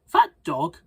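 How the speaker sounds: sudden high loud start; squeaky skeleton voice. Acted. Big skeleton remarks on the dog, high-voiced. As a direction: pop up high on the first words.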